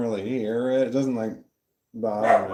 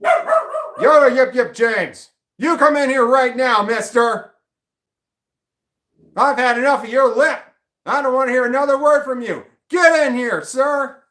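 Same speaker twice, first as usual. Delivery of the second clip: mock stern mum; 12 semitones higher, 6 dB louder than usual. Owner calling the dog by full name, mock-furious yell. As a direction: scold the dog like a furious mother.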